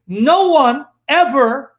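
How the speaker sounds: No one ever, each part slow and punched; slow, loud stress on NO ONE and EVER; emphatic quoting. Real, not acted. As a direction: lean hard on No one, pause, then hit ever. Stresses the disputed misquoted words.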